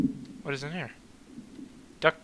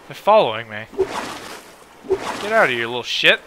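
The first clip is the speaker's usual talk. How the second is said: ordinary talk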